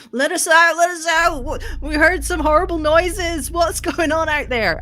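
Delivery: high, loud panicked-sounding yell through the door; band manager calling out. Acted. Band manager shut behind the door, high-pitched shouting. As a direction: shout it high, demanding to be let out. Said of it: "the band manager going".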